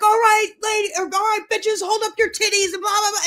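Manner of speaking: high, bossy guard orders; imitated prison guard. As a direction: mimic a guard ordering inmates about.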